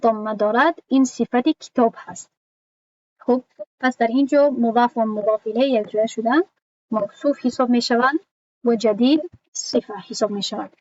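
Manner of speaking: normal talking voice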